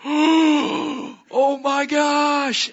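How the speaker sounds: very high, loud, stretched-out awe; amazed squeal. Retold or imitated. Woman seeing rainbows, acted amazed squeal.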